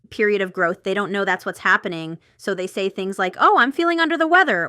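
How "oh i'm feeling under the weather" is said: high, louder whiny mock complaint; mock complaining person. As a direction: mimic someone moaning they feel ill. Mocking what unaware people say.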